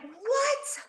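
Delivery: high rising disbelief; shocked what. Retold or imitated. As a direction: squeak out a stunned what. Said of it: "And you're like".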